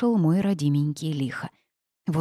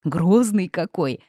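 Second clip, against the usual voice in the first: slow, drawn-out teasing admiration; chuckling; 3 dB louder, slower than usual. A narrator's reading. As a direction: tease the lord with mock awe. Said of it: "хохотнула Васька".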